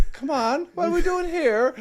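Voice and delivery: put-on high, sing-song Cork accent; Tomas O'Leary Cork accent. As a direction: throw on a playful Cork accent. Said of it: "that's my Cork accent".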